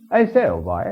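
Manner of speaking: hammed-up English gentleman's greeting; perfect English accent. Father imitating his son fooling cabbies, in perfect English accent. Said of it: "he spoke perfect English".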